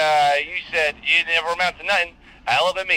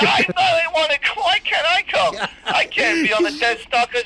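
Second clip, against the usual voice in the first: whiny; 11 semitones higher than usual. Acted. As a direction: whine like a sulky kid. Whining at being left out.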